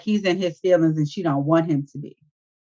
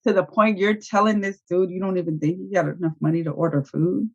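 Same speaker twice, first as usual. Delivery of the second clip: ordinary delivery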